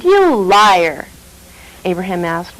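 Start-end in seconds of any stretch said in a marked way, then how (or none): 0.0-1.1 loud accusing cry; exclaimed. Acted. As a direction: throw the accusation at him. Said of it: "the high priest exclaimed".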